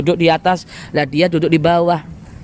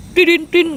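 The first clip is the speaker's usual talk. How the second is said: car horn; 10 semitones higher than usual. Retold or imitated. Luxury car suddenly honking at the grass cutter, imitated high honk. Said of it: "ada mobil mewah klakson".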